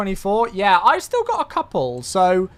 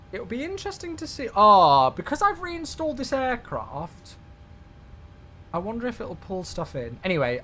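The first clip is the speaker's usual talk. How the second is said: ordinary delivery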